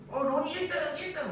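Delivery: imitating his son. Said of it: "맨날 이래".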